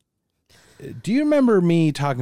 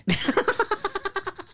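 high breathy giggle; 5 dB quieter than usual. Real, not acted. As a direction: let out a squeaky laugh.

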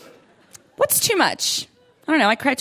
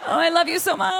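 laughing mock gush; 6 semitones higher, faster than usual. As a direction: overdreven verliefd, lachend. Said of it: "and I'm like".

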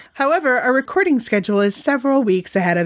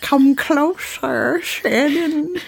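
slow creepy beckoning; creepy whisper voice; 3 semitones higher, much slower than usual. Pretend. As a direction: lure her in, slowly, menacing. Playful creepy voice.

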